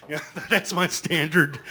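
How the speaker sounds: usual speaking voice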